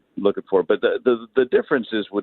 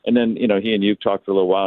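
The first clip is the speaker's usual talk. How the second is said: ordinary talk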